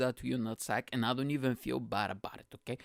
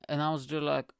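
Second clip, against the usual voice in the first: ordinary delivery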